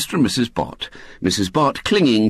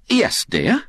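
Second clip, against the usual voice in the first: mild innocent lift; innocently; 6 semitones higher than usual. Acted. Aunt Jo answers Mrs Bott, mildly innocent. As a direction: play the innocent sweetly. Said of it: "said Aunt Jo innocently".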